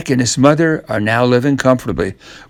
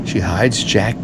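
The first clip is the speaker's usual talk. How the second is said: usual speaking voice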